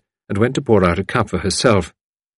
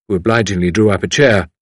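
ordinary delivery, nothing marked

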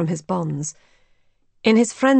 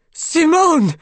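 high, loud shout of a name; anguished cry; 9 semitones higher, 6 dB louder than usual. A narrator's reading. Raoul hearing Simone scream, crying out her name. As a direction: call out in desperate alarm. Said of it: "cried Raoul".

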